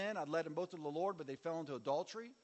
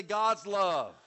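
louder shout of the phrase; call and response; 10 dB louder than usual. Shown as a demonstration. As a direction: lead the crowd loudly. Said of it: "Everybody say".

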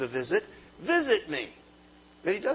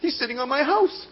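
mock alarmed discovery; 9 semitones higher, 8 dB louder than usual. Mock revelation about demons.

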